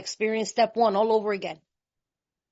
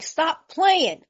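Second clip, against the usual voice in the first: irritated; 7 semitones higher than usual. Retold or imitated. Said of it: "The man's like".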